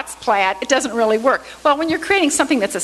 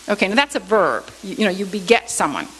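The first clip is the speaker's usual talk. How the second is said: ordinary talk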